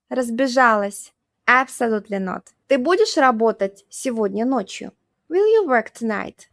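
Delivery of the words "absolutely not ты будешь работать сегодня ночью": usual speaking voice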